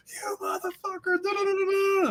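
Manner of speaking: high-pitched mock freak-out; high, strained cursing into nonsense syllables. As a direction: imitate a man losing it in a high squeal. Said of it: "freaking out".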